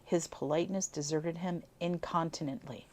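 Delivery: plain reading voice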